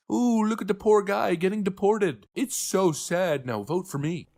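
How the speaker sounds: high, hammed-up pity voice mocking a politician; mock sob-story politician. Commentator mocking a crying politician, in a high mock-pity voice. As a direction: pile on the fake sympathy. Mocking Democrats' fake emotion.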